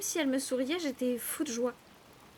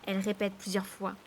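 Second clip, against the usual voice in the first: plain reading voice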